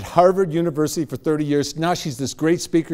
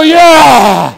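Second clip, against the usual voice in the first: huge, high triumphant shout; proud cheer; 9 semitones higher, 14 dB louder than usual. Shown as a demonstration. Speaker showing the crowd the power pose, proud triumphant cheer. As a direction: yell it like a record-breaker. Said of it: "Come on, be proud about it. And go".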